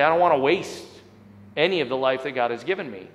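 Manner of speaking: ordinary talk, nothing marked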